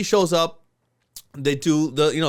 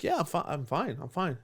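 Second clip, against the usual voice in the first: dazed quiet; 9 dB quieter than usual. Retold or imitated. Dazed self, reassuring.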